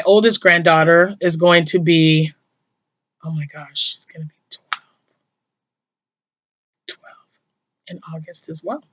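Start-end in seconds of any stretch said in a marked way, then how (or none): 3.2-8.8 whispered, close to crying; tearful whisper. Real, not acted. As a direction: drop to a whisper as the tears come. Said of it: "before I start crying".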